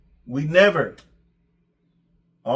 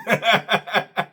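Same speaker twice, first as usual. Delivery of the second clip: amused laugh; 5 semitones higher than usual. Real, not acted. Laughing at coach's excuses.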